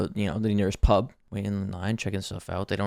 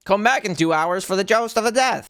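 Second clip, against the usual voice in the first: high announcer voice; 10 semitones higher than usual. Retold or imitated. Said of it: "And they're like".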